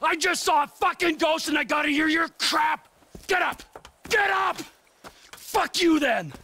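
furious yelling. Acted. Trevor screaming at the bikers after the beating, furious. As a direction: yell down at them, out of control.

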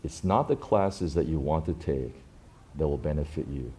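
a hint of a stern teacher, quiet and weighty; stern mentor. Seminary pastor to Sam, quietly stern. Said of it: "he got very stern".

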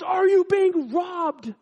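slow, high shouted question; big, drawn-out mock holler; retold yell. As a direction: yell it down the phone as the alarmed boss, acted. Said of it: "I knew the guy heard him yell".

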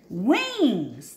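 usual speaking voice

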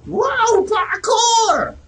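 very high, drawn-out falsetto; playful stunt cry. Pretend. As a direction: squeal it out like a stunt call. Calls out a game stunt.

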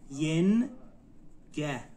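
ینگه drawn out as ین, long pause, گه; slow teaching demo of ینگه, split in two; syllable by syllable. Shown as a demonstration. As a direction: sound out ینگه very slowly in two parts. Teacher breaks word into syllables.

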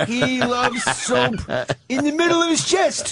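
slow Keitel impression doing Bruce; Harvey Keitel impression. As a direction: stretch it out like a hammy Keitel. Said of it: "Harvey Keitel doing Bruce".

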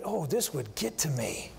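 a put-upon father muttering under his breath; grumbling parent. Retold or imitated. Preacher imitating himself answering his sons, low grumble. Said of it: "and I".

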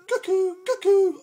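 loud high cuckoo; cuckoo clock call. Acted. Living room clock waking dad, loud high cuckoo. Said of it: "went the living room clock".

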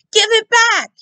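put-on high, loud boyish yell; furious shout. A narrator's reading. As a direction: yell it at her as she runs. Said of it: "he shouted".

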